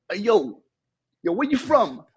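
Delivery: put-on East Side kid. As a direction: call out a challenge like an American neighborhood kid. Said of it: "East Side said".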